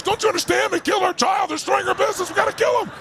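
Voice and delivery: high-pitched ranting imitation; imitating furious friend. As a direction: act out the enraged friend demanding revenge. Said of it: "my furious friend over here".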